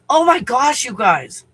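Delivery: high, loud oh my gosh; exasperated outburst. Real, not acted. Vlogger to viewers, high and loud. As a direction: burst out overwhelmed. Chaos with barking dogs.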